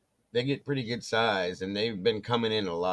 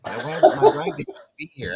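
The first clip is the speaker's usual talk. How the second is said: higher and louder through laughter; wife laughing off the danger, higher and louder; laughing protest; 8 semitones higher, 9 dB louder than usual. Retold or imitated. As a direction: giggle out the excuse.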